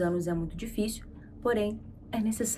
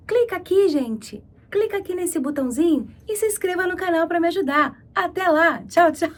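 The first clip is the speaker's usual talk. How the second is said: excited plea; 8 semitones higher, 7 dB louder than usual. Real, not acted. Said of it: "begging viewers to subscribe".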